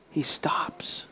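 a hushed, breathless whisper; real emotion choking the words. Real, not acted. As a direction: let it fall to an awed whisper. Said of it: "I'm emotional".

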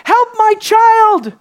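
very high, drawn-out imitated cry; loud cry. Preacher imitating the father begging Jesus, crying out high and slow. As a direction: call out like a pleading father. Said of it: "he cried out in a loud voice".

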